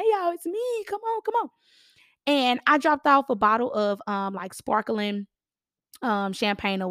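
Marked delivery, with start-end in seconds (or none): 0.0-1.5 high, sing-song calling out to the camera; a bright high hello at the doorbell; calling at the door. Retold or imitated. Realtor calling to clients through their door camera, high and sing-song.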